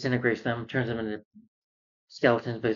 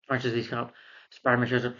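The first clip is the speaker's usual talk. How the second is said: ordinary delivery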